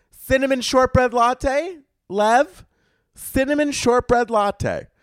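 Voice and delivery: high, drawn-out order call; barista calling out order. Acted. Barista announcing a drink to the whole Starbucks, high-pitched call-out. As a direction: sing out the order in a high, slow voice. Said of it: "they call out your order to people".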